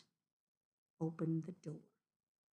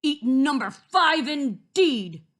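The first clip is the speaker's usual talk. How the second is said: loud, raised-pitch wolf outrage; big booming indignation; indignant shout; 6 semitones higher, 12 dB louder than usual. A narrator's reading. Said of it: "shouted Big Bad Wolf".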